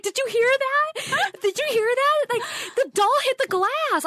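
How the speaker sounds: high, urgent and breathless; frantic questions after hearing a bang; scared friend. Retold or imitated. Friend frightened by the doll.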